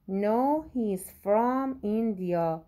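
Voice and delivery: very slow, word by word, for learners; slow model reading. Shown as a demonstration. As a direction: spell it out slowly for the class. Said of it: "teacher reads model answer slowly". (آهسته و شمرده برای شاگردان)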